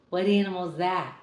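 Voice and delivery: usual speaking voice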